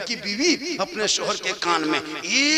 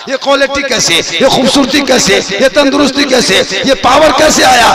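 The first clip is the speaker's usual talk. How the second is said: loud, higher questions in a building volley; insistent challenge; 3 semitones higher, 15 dB louder than usual. Retold or imitated. Maulana challenging a doctor on morning air, loud insistent questioning. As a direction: hammer each question at the doctor.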